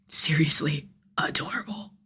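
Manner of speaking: hushed whispered aside. A narrator's reading. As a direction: whisper it like a secret.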